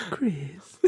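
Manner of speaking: very high, breathy falsetto calls. Retold or imitated. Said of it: "the way [name] did it".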